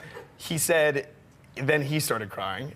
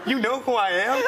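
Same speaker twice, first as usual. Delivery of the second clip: retold disbelief, higher; 8 semitones higher than usual. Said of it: "I go".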